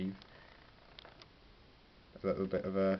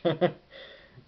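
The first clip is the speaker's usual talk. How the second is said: laugh; 9 semitones higher, 8 dB louder than usual. Real, not acted. Laugh after the spare cash joke.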